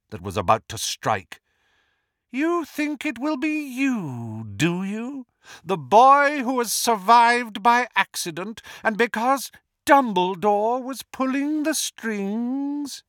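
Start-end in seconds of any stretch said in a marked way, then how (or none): 2.3-13.0 jeering villain. A narrator's reading. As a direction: sneer at your rival, high and taunting. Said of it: "jeered Voldemort".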